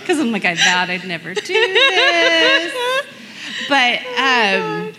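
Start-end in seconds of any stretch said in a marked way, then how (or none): 0.4-2.7 mock earnest vow. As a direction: say "I vowed I'd never do this" higher and slow, a half-laughing mock vow. Quoting her younger vowing self.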